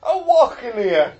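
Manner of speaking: high, slow, loud mock Walken; half-hearted Walken drawl, slow and loud; Christopher Walken impression. Host doing Walken for his co-hosts, high and slow. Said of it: "I'm Christopher Walken here".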